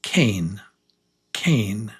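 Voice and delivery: cane with a long "ay". Shown as a demonstration. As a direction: lead the class in saying cane. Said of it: "Say it one more time with me".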